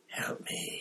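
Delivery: breathless whispered plea; frightened unvoiced whisper; whispered moan. A narrator's reading. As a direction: whisper it in terror. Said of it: "he moaned to Hermione".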